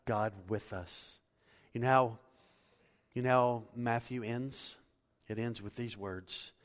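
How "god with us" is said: ordinary talk, nothing marked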